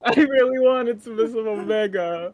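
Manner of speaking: high, loud gleeful squeal; excited squeal. Retold or imitated. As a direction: squeal it out delightedly. Excited squealing confession to quiz.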